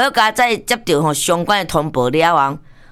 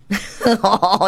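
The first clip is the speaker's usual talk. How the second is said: short hearty chuckle; real amused laugh; 2 semitones higher than usual. Real, not acted. Host laughing that the caller hung up, amused. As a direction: chuckle at your own punchline.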